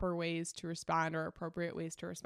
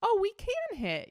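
much higher, louder baby-voice imitation; imitating the baby; 13 semitones higher, 5 dB louder than usual. Mother voicing her baby daughter's takeaway, much higher and louder. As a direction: voice the toddler's discovery, much higher. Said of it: "Adeline's gonna see".

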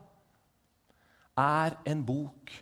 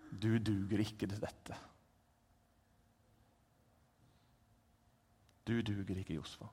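low cold verdict; 6 semitones lower, 7 dB quieter than usual. Acted. Satan telling Josva he is unfit, low and soft. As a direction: drop it down and pass sentence.

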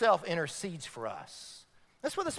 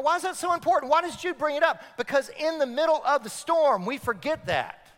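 ordinary talk, nothing marked

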